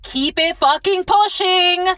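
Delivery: high, slow, dismissive. Real, not acted. Dismissing the gold digger, high and slow. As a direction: brush them off.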